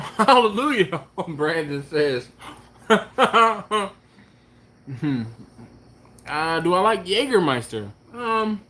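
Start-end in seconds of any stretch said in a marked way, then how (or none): 0.0-1.3 laughing hallelujah. Real, not acted. Laughing reaction to cheap meat. 2.6-5.2 high laughing mm-hmm; amused agreement through laughter. Real, not acted. Laughs at viewer comment.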